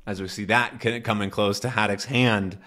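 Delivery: ordinary talk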